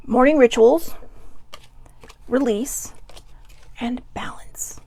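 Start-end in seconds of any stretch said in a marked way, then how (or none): 2.3-4.8 "And balance" breathed out, much softer, slow; soft whisper. Acted. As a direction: let the last word fade into a whisper. Closing the card list softly.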